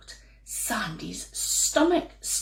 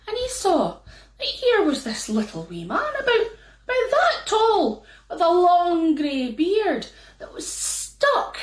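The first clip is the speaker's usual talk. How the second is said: ordinary delivery, nothing marked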